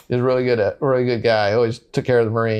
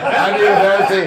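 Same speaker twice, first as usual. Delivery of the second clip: laughs through the line, higher and louder; giggling as he asks for the soda; laughing quote; 6 semitones higher, 5 dB louder than usual. Retold or imitated. Wounded Marine retelling his request to the nurse, laughing.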